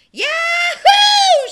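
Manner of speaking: very loud, high cowboy whoop; joyful yell. A narrator's reading. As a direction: yell with joy jumping on the horse. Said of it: "shouted Bubba".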